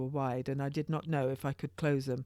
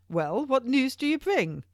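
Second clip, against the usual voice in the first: the king demands the news, raised and high; impatient king; 10 semitones higher, 7 dB louder than usual. A narrator's reading. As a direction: press the messenger for his news. King voiced loudly.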